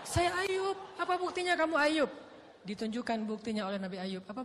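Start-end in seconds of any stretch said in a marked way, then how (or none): 0.0-2.1 high, loud with a laugh; demanding wife. Acted. Wife to Ayub demanding proof, high and loud.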